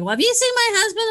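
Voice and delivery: high, quick mimic of an elderly mother; mom's voice. Daughter imitating her mother asking about her husband, high voice. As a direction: lift way up to play the confused mom. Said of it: "she'd be like".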